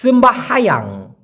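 সেম্বাহায়াং said slowly, sem-ba-ha-yang; slow model of সেম্বাহায়াং; correct pronunciation. Shown as a demonstration. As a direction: spell out সেম্বাহায়াং syllable by syllable. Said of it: "সঠিক উচ্চারণটা হবে সেম্বাহায়াং".

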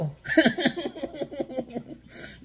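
sudden high burst of laughter; real amused laugh. Real, not acted. Laughing at own joke.

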